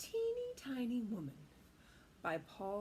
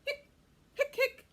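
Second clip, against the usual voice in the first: hiccup sounds; 14 semitones higher, 10 dB louder than usual. Acted. Skeleton hiccuping.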